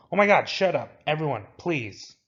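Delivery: loud, high outburst, easing into a plea; exasperated plea for silence. Acted. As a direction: cut through the bickering, fed up. Character silences the bickering group.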